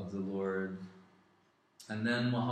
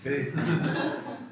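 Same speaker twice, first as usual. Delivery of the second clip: slow, louder, laughing delight; 4 semitones higher, 6 dB louder, slower than usual. Real, not acted. Speaker echoing the chant to the listener, laughing. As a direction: stretch the name out through a laugh.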